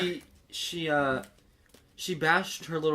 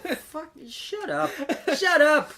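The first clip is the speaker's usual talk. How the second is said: mock angry outburst; 13 semitones higher, 5 dB louder, slower than usual. Retold outburst at the neighbor.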